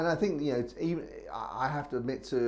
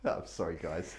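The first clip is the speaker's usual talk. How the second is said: laughing; 7 semitones lower than usual. Real, not acted. Apologising while still laughing.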